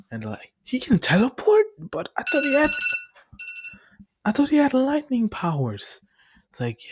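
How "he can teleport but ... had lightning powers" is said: high, loud disbelieving questions, imitated; acted-out incredulous outburst; astonished. Group astonished by his power.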